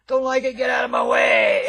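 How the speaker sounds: loud, high, belligerent shout; ingratitude shout. Acted. Hippie mocks an ungrateful man who yells at others. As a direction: bark it at anyone blocking your path. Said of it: "that's the attitude of ingratitude".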